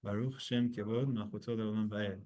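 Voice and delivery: low, soft prayer undertone; murmured liturgical aside; quiet undertone response. Shown as a demonstration. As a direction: drop to a hushed recitation. Undertone response within Shema recitation.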